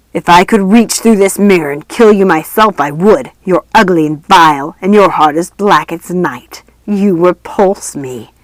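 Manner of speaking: grind her down with contempt; cruel ghost voice. A narrator's reading. Mirror ghost keeps tormenting queen.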